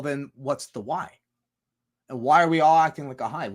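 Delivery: normal talking voice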